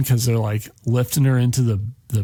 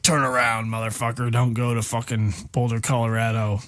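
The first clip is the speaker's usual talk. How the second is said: softer, put-on threatening voice; dying man warning Stu away from Boulder, softer and menacing; 4 dB quieter than usual. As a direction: warn him off in a hushed menacing tone.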